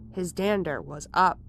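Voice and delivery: ordinary delivery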